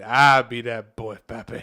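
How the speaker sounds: movie-line imitation. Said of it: "my favorite line from that fucking whole movie".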